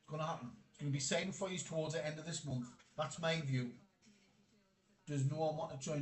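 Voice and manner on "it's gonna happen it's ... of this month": ordinary talk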